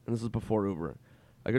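ordinary talk, nothing marked

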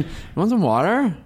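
lightly exaggerated upspeak on "water". Shown as a demonstration. Host showing how Valley speech ends, upspeak on "water". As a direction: lift the pitch on "water" into a question. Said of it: "end as a question".

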